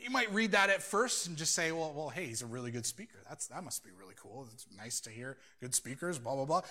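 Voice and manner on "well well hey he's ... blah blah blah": low, soft, offhand musing that peters out; casual shrug. Acted. Someone reading the verse at first glance, casual shrug. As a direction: mimic a mildly impressed, indifferent reader. Imagined reader's shallow, dismissive reaction.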